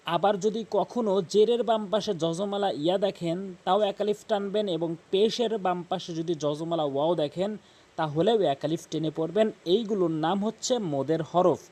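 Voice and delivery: usual speaking voice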